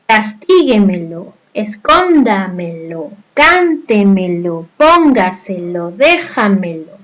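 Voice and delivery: Castíguemelo, escóndamelo, cántemelo, póngaselo, déjamelo slowly, leaning on each accented syllable; early-syllable stress. Shown as a demonstration. Teacher reading out sobresdrújulas such as Castíguemelo, accented syllable stressed slowly. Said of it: "Y las sobresdrújulas de la misma manera".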